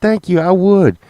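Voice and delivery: high, prim mock-polite thanks from the wife; mimicking wife. Imitating Sunshine gladly accepting the pillow, high and prim. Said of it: "She's like".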